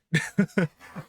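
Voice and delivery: bright burst of laughter; high, loud laugh. Real, not acted. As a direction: laugh at your own outburst. Laughs after own outburst.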